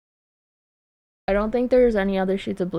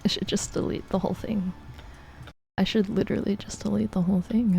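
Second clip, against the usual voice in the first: defeated near-whisper; 2 semitones lower, 11 dB quieter, faster than usual. Real, not acted. Streamer wanting to delete the whole drawing, defeated quiet mutter.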